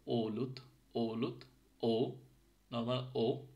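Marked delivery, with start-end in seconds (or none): 0.1-2.1 "অলুত" with a long round ও; slow teacher's demo of "অলুত, ও"; Finnish O sound. Shown as a demonstration. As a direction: draw out the ও opening "অলুত", then ও alone. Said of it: "অলুতে যে ওটা আছে".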